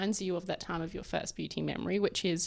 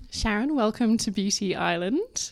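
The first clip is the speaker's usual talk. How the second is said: bright welcome; 10 dB louder than usual. Real, not acted. Host greets guest warmly.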